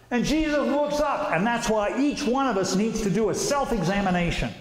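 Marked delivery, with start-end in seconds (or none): none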